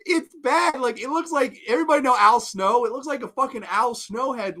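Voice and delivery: high-pitched exasperated complaint; real incredulous exasperation; losing it. Real, not acted. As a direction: rant on in pained disbelief. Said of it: "I'm losing my shit".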